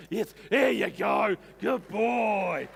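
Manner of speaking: slow, shouted praise for the dog; loud praise. Real, not acted. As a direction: cheer the dog on loudly.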